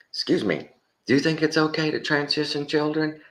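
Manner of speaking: high-pitched mock interviewer; street interviewer. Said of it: "now going around going".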